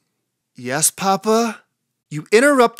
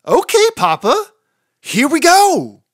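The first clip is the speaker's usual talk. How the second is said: eager start; 8 semitones higher, 4 dB louder than usual. Acted. Chicken excitedly begins her own story for Papa, high and loud. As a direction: launch in with delight.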